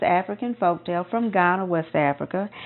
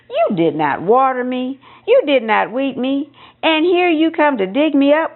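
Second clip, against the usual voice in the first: a lightly voiced indignant yam, higher; talking yam; 6 semitones higher than usual. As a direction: scold the farmer as a yam, rising higher. The yam scolds the farmer.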